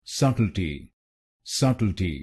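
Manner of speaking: subtlety with the weight on "sub"; stress on first syllable. Shown as a demonstration. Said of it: "पहले शब्दांश पर ... जोर डालना है".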